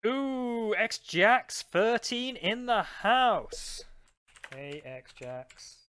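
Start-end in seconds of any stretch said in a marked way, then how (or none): 0.1-3.8 loud, high, drawn-out hype greeting; hype shout-out. Real, not acted. Streamer welcomes a viewer into the chat, loud drawn-out hype.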